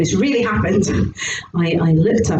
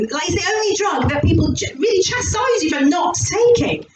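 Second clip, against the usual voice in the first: normal talking voice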